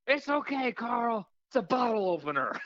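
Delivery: high-pitched female screener calling out; mock high voice announcing the all-clear; security woman. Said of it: "she holds it up to the dude".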